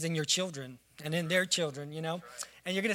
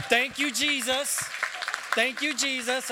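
raised, higher-pitched exclamation of thanks; real praise shout; 7 semitones higher, 4 dB louder than usual. Real, not acted. Leading the congregation's applause for Daisy, praise shout. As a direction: call it out over the applause.

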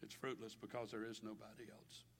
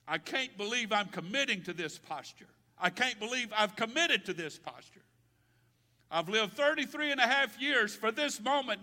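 higher, louder, straining in distress; anguished Jesus; 9 semitones higher, 15 dB louder than usual. Acted. Jesus in the garden dreading his task, anguished cry. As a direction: cry out as Jesus breaking under pressure.

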